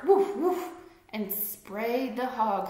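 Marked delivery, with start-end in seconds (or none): 0.0-0.7 high, loud barks; barking dog. Acted. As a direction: bark it like a startled dog. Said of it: "who barked".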